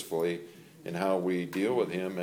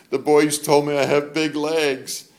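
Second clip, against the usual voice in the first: tearful daughter; 6 semitones higher, 7 dB louder than usual. Retold or imitated. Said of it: "She goes".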